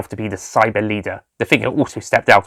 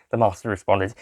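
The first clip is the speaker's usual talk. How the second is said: usual speaking voice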